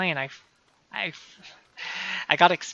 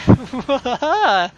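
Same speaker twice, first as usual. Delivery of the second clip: higher, louder maniacal laugh; mad scientist laugh; 8 semitones higher, 8 dB louder than usual. Pretend. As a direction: cackle like a cartoon villain. Laughter after the taunt.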